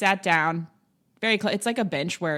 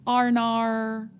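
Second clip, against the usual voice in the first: put-on a stretched-out Australian accent; 6 semitones higher, much slower than usual. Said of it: "The only thing I can say is".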